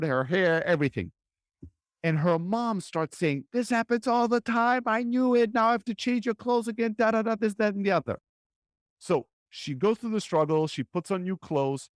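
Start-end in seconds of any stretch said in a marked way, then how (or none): 3.5-8.2 high, fed-up scolding rant; exasperated mom. Retold or imitated. Mom scolding her messy daughter, exasperated high voice. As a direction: complain like a harried mother.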